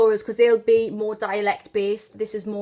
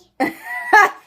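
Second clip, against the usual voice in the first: high, loud burst of laughter; real laugh; 9 dB louder than usual. Real, not acted. Mum hearing her daughter's attempt, loud high laugh.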